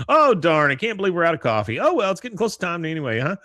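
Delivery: high, fast fake-surprised chatter; hint at guests leaving, high and quick; Peggy voice. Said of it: "She goes".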